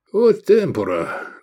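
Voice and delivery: high, slow mock lament; sighing lament. A narrator's reading. Prisoner commenting on being sold, sighing lament. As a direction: lament the times, drawn out. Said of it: "вздохнул Искандер".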